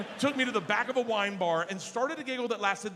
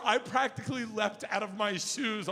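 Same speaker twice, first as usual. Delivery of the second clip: normal talking voice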